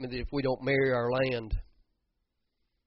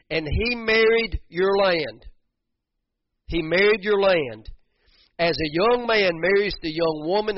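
loud, high preaching; fervent sermon climax, slowed on the key lines; preaching at full force; 6 semitones higher, 7 dB louder than usual. Acted. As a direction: ring it out over the congregation.

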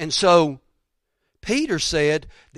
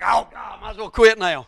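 mock despair; 6 semitones higher, 6 dB louder than usual. Mock despair in retold reaction.

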